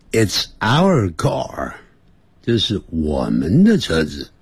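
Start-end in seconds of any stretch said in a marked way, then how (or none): 0.1-1.7 慢速示范 It's our car; 一字一字慢慢念; slow model answer. Shown as a demonstration. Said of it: "回答的时候呢，就说". (放慢速度示范回答)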